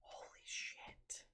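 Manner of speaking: stunned, slow whisper; barely-there whispered shock; awed whisper. Real, not acted. Stunned hushed repeat of exclamation.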